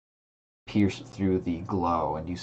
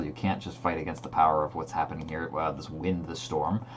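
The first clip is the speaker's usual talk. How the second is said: normal talking voice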